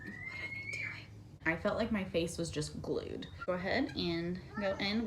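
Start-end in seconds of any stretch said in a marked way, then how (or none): 0.0-1.1 very soft, whispered aside; hushed aside. Real, not acted. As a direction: whisper it under your breath, off to the side. Aside about noisy kids off-camera.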